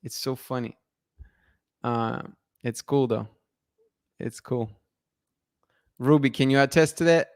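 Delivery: ordinary talk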